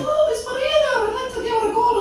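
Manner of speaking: very high-pitched comic Scottish woman; Scottish accent. Acted. Half-Scottish friend chatters away, piercingly high Scottish accent. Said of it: "because she's half Scottish".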